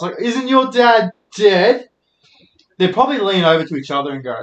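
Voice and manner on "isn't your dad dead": high, slow jeering question; mock taunt. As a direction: stretch out the cruel question, jeering. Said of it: "no one would say it in front of the teacher".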